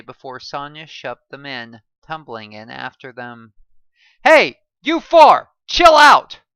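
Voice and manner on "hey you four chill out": high, loud, slow scolding shout; angry scolding. A narrator's reading. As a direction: bark at unruly swimmers. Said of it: "a lifeguard angrily scolded us".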